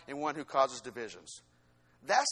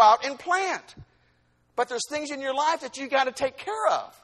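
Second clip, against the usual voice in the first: high, loud exhortation from the pulpit; insistent preacher, pitched up and driving; forceful preaching; 7 semitones higher, 7 dB louder than usual. Real, not acted. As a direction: press the correction home in a raised preaching voice.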